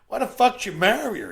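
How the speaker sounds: high, very loud disbelief; exasperated shout. Acted. Concierge losing patience with the new groom, exasperated shout. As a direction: blurt it out at full volume.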